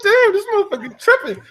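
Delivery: very high, fast squeaky mock voice; high cartoonish voice of the Xbox. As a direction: voice the console mocking him. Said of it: "you should have heard Xbox like".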